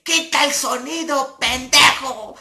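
high, loud barked command; angry order. Retold or imitated. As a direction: snap at the sound guy to kill the mic. Fat organizer orders sound cut.

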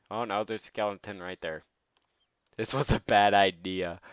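loud panicked alarm; panicked while fleeing monsters; being chased. Real, not acted.

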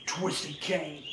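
usual speaking voice

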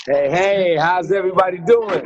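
loud, booming hello to everyone; hearty shouted greeting. Real, not acted. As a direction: call out big to the whole crowd. Calls out greeting to online group.

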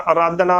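ਆਰਾਧਨਾ with the first vowel cut to a short "a"; common mispronunciation. Shown as a demonstration. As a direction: say ਆਰਾਧਨਾ as "a-raadhna", short opening. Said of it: "ਗਲਤ ਉਚਾਰਨ ਹੋਵੇਗਾ".

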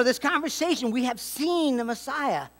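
normal talking voice

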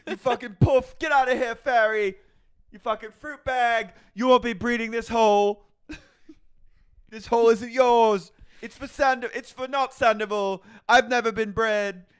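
high, loud cartoon-lad tirade; mock angry James. Podcaster voicing James's homophobic rant, high loud mock shouting. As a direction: rant it in a squeaky shouting put-on voice.

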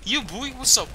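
high taunting bro voice; frat bro. Acted. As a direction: gloat like a cocky frat bro. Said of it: "taunting after a kill".

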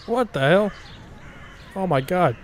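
startled gamer exclaim; shocked. Real, not acted. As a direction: blurt it out in disbelief. Shocked by sudden scene.

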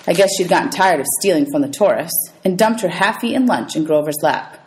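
usual speaking voice

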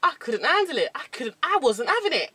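put-on Cockney grumble; Cockney accent. Shown as a demonstration. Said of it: "we're doing Cockney".

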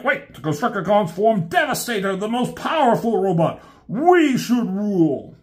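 high, insistent robot boast; Constructicon voice. Retold or imitated. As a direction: push your claim like a brawny rival. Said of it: "The Constructicon's like".

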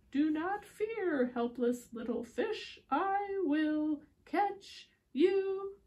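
slow, grand rescuer voice, rising high at the end; drawn out, heroic promise; hero's call. A narrator's reading. Storyteller voicing the rescuer again, slow and grand. Repeat of hero line.

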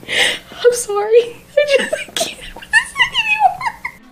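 high, slow, laughing whisper near tears; can barely get the words out for laughing; helpless laughter. Real, not acted. Cracking up at a character's face.

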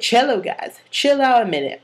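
high, loud acted order; Santana. Santana telling the cello players to wait, high loud imitation. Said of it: "She's like".

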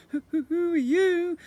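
high, loud stammering question; terrified stammer. Acted. Ned, trembling, stammers at the slime monster. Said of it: "stammered Ned".

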